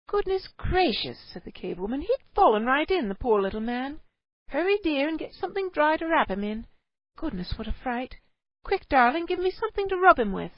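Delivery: high, hurried, worried fussing; urgent care for the soaked child; anxious mother. A narrator's reading. Cave mother asks her husband for dry things, anxious.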